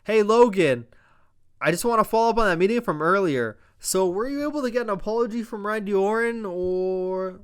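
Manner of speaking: hammed-up smug GM voice; imitating Nick Aldis. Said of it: "comes out and says".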